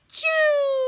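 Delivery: loud high hammed-up achoo; big sneeze. Storyteller acting the bear's sneeze, loud and high. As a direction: blast out a huge fake sneeze. Pepper makes bear sneeze.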